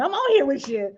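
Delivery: ordinary talk, nothing marked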